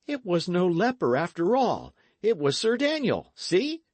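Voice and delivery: a lad cheering his friend; young Dick. A narrator's reading.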